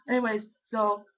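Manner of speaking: normal talking voice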